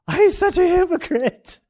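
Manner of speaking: exasperated self-rebuke. Retold or imitated. Self-rebuke in retold moment.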